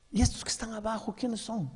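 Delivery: put-on high, quick, soft childlike question; curious small child. Little boy asking his mother about the picture, high and soft.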